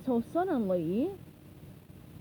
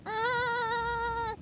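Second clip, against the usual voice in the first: high yelp; 11 semitones higher than usual. A narrator's reading. Character yelps in battle.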